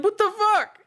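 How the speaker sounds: high-pitched retold exasperation; comic indignant squeal; mock outrage. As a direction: complain high, in comic disbelief. Retold outrage over wanted level.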